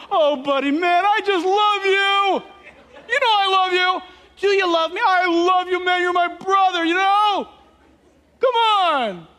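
put-on loud, high, gushing drunk affection; hammed-up boozy declarations of love, words stretched out; jovial drunk. Drunk professing love to his buddy, loud and jovial. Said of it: "The first stage of drunkenness".

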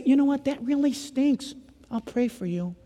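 mock consoling a friend, dropping away quietly; acted limp sympathy. Acts out a friend's useless sympathy.

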